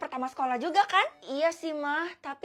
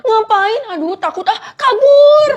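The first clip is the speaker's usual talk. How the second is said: high, loud yelp rising to a shout; panicked cry; 8 semitones higher, 16 dB louder, slower than usual. Acted. Girl flees a stranger, panicked cry. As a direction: cry out in alarm as you bolt.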